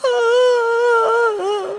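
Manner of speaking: high strained wail of helplessness; pained cry. Retold or imitated. Wife crying out while being ordered to get up, high wail. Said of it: "And I'm like".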